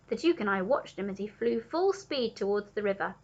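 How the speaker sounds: plain reading voice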